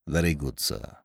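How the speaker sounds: low, soft, discreet servant; reply as an imperturbable butler, very quietly; hushed, formal obedience; deferential valet. A narrator's reading. Valet Jeeves answers his employer.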